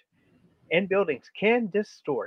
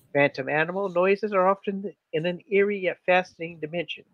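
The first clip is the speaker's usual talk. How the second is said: normal talking voice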